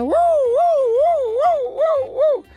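high, slow siren wail woo woo; ambulance siren. Retold or imitated. Crocodile jokingly imitating an ambulance siren, high wailing woo. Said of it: "haciendo como ambulancia".

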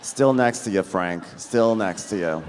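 low, falling, long-suffering answer; weary deadpan reply. Retold or imitated. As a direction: answer the shouter with tired patience. Weary acted reply to Frank's yelling.

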